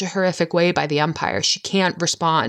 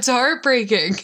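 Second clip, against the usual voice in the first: high, aching exclamation; heartbroken; 8 semitones higher than usual. Real, not acted. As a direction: let it break your heart. Co-host reacting emotionally.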